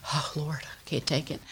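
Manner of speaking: very soft aside, as if to God; hushed dismay. Retold or imitated. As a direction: imitate a weary under-the-breath plea. Retold dread at son's confession.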